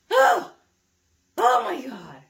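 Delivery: very high, loud oh my God; loud comic exasperation; exasperated cry. Real, not acted. Exasperated exclamation.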